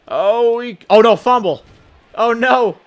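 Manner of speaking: high, loud cry of dismay at a fumble; real panicked outcry; dismayed shout. Real, not acted. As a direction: yell oh no as the ball comes loose. Fumble during the drive.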